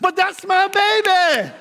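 high, loud, slow proud cry with a laugh; proud mama laughing. Retold or imitated. Mother at graduation calling out, laughing proud cry. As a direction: crow it like a doting mother.

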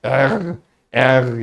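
"R" as er with a rough back-of-throat R; German throaty R. Retold or imitated. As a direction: drag out "R" without rolling it. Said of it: "Almanlar pek R'leri çıkartamıyorlar".

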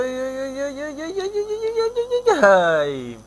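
a long rising groan of suspense; anguished ai. Real, not acted. Near miss in the game.

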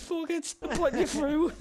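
mildly mock an impulsive TV judge; Louis Walsh. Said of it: "Louis Walsh, just on live T V, just says".

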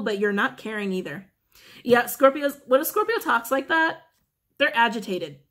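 ordinary delivery

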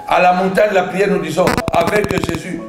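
ordinary talk, nothing marked

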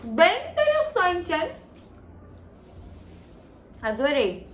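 chewing candy while talking; muffled chewy speech; mouth full, chewing. Real, not acted. Seen 1: Eyeball gummy put in mouth at -5; jaw keeps chewing while talking.

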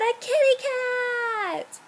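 high, stretched-out storybook surprise; gleeful reveal to young listeners; amazed reveal. A narrator's reading. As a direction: draw it out and lift it high.

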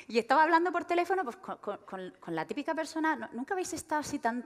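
ordinary talk

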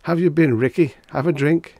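high, loud tipsy welcome; cheery drunk offering a drink; tipsy cheerful greeting. Acted. Tipsy Reggie greets a stranger.

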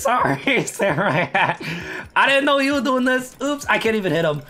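ordinary delivery

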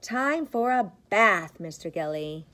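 ordinary delivery

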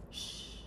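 sh breathed softly without voice; teacher prompting students to join; rest sound. Shown as a demonstration. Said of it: "Can you say that with me?".

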